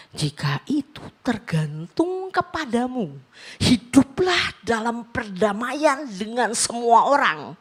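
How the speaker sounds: slow, measured recitation of the verse; solemn scripture quote. Acted. Preacher recalls the Bible verse that stopped him, slow and solemn.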